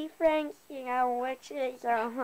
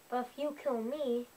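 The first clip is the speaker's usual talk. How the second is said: usual speaking voice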